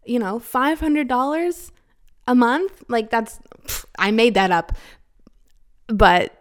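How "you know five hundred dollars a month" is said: higher, soft airheaded voice mocking a beginner; playing a clueless beginner for laughs; mock naive newbie. Podcaster mocking new sex workers, high airheaded mock voice. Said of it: "for someone who's like".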